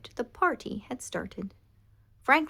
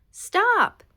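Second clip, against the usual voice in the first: high, loud shout of stop; 13 semitones higher, 11 dB louder than usual. Acted. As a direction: shout to halt them. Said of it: "he shouted".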